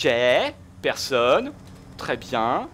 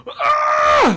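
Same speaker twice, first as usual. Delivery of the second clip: very high, loud scream; shrill rage scream; 8 dB louder than usual. Real, not acted. Gamer killed again, screaming high and loud. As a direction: let out a sudden scream.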